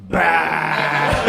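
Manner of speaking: comic startling yell; mock scare shout. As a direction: blast it back to scare the driver. Kid screams back at frightened driver.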